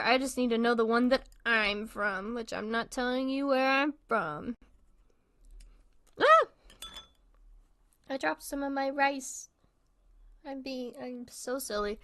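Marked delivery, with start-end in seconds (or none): 6.2-6.5 sudden high yelp of surprise; a sharp startled "ah". Real, not acted. Dropped rice, startled cry.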